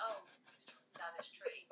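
low, hushed whisper; gossiping neighbour. Acted. Neighbour spotting a man in his kilt, hushed whisper. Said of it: "everyone in the neighbourhood would be like".

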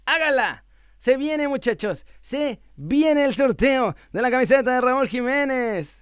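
high, slow hype announcement; excited hype. Real, not acted. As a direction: build up the raffle. Hypes the giveaway. (anuncio emocionado)

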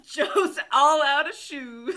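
laughing. Real, not acted. Joking farewell line.

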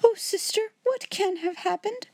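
very high, distressed wail; tearful. A narrator's reading. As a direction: plead in weepy dismay. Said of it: "wring her fat hands and cry".

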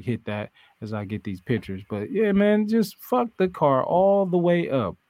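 normal talking voice

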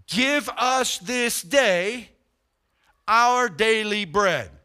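slow prayer line. Retold or imitated. Drawn-out prayer quote.

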